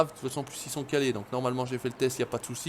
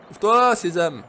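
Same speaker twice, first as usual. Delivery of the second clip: loud, higher magic-command call; 4 semitones higher, 8 dB louder than usual. Pretend. Player ordering a door open, mock-grand call.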